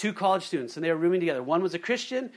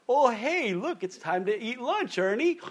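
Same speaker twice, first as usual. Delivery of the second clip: fish voice; 5 semitones higher than usual. Pretend. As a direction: play a gullible fish spotting bait. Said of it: "the fish will go".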